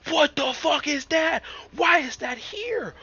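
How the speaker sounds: panicked shrieking. Real, not acted. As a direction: scream in shock at a sudden monster. Sudden enemy scares him.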